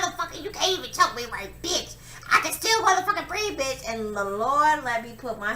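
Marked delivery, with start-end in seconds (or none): 0.0-3.9 higher, louder, fast mocking taunt; furious mocking taunt; taunting defiance. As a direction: spit the insults at him while pinned. Taunting him while being choked.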